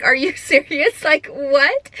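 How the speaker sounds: retold shocked disbelief. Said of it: "I just couldn't stop laughing".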